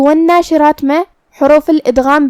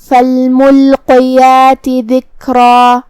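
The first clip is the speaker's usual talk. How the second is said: slow, measured tajweed chant; Quranic recitation; 4 dB louder, much slower than usual. Shown as a demonstration. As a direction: recite with long held vowels on a level tone. Recites verse as demonstration.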